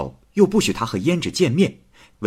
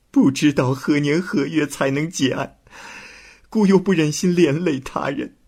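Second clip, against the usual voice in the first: crying softly; 8 semitones higher than usual. Acted. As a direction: sob out her confession. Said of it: "胭脂哭着说". (胭脂哭着向太守诉说)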